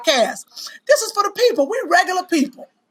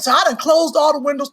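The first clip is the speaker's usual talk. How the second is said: ordinary talk, nothing marked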